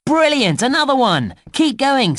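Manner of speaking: high-pitched, delighted encouragement; thrilled game-host praise; excited cheering on. Acted. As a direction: cheer the player on with rising excitement. Cheering player on after a find.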